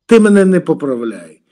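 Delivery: loud, furious snap; angry shout. Real, not acted. A man bristling at his rival's correction, loud angry shout. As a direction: shout him down for correcting you. Heated argument over language.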